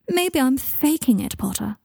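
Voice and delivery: slow, high-starting sneer at a rival; schoolboy taunt. A narrator's reading. Malfoy needling Harry as at school, taunting. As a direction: draw out the needling, then drop on the surname. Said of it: "sounding more like he did at school".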